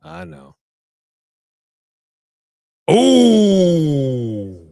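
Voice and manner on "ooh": loud drawn-out pained ooh; loud wince. Real, not acted. Reacting to a brutal strike, long loud ooh. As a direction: groan at the big hit.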